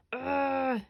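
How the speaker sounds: loud, high exasperated yell; mum yelling. A narrator's reading. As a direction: burst out in frustration at the walls. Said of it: "yelled Mum".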